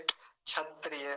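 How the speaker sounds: क्षत्रिय said slowly as छत्रिय; wrong chh sound. Shown as a demonstration. Teacher shows the common wrong छ version slowly. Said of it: "ये गलत उच्चारण है".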